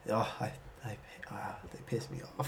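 hushed, half-whispered grumble; muttered irritation. Real, not acted. Player recalling the opposing team, muttered irritation. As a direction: grumble it softly under your breath.

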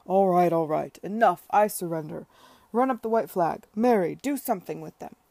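loud, laughing give-up; loud mock surrender. Tom surrendering to his children, loud mock surrender. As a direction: shout over the rowdy children.

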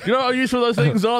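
high-pitched, hammed-up indignant protest; comic alarm with laughter creeping in; mock outrage. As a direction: play a scandalised partner, pitched right up. Acted outrage over the dishwasher gift.

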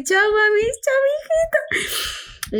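high, crying, stretched farewell; tearful goodbye. Retold or imitated. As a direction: sob out the goodbye through tears. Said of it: "yo ahí con mis lágrimas y ella con sus lágrimas".

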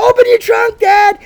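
put-on very high, loud child's plea; excited kid voice. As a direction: beg like an eager kid. Voicing excited kids.

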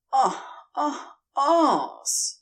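short high fake moans; mock pleasure cries, staged for Gabriel; faked rhythmic moans. A narrator's reading. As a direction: pant short ahs in rhythm. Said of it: "vulgar counterpoint".

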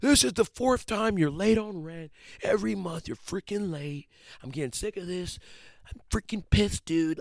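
high-pitched, whiny tantrum voice; mock angry fit. Landlord calling tenant about late rent, mock angry fit. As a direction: throw a hammed-up little fit over the phone. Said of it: "They don't throw little fits".

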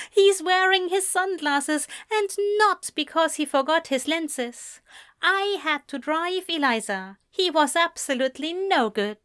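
lively, tickled exasperation; amused gossip. A narrator's reading. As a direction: dish it out after a snort of laughter. Ezra snorts, reports on hungover Anthony.

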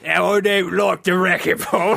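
exaggerated, slurred English vowels; mock English accent. Club lady disagreeing about racquetball, exaggerated English accent. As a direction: put on a rough, silly English accent. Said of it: "subdued English lady".

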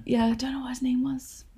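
whispered aside. Real, not acted. Unvoiced whisper measured.

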